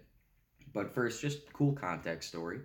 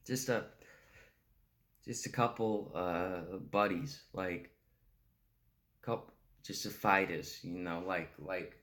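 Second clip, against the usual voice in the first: put-on pathetic, childlike junkie rambling, stammering and restarting; needy junkie monologue, pitch lurching up as the words stumble; Fick. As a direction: plead like a strung-out kid, with halting pauses and nervous laughs. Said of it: "Fick is described as a pathetic, childlike junkie".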